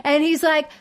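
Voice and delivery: ordinary delivery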